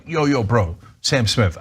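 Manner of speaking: ordinary talk, nothing marked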